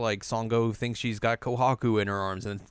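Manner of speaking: ordinary talk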